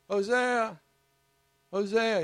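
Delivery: calling across a field, high and loud. Acted. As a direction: call his name from far off.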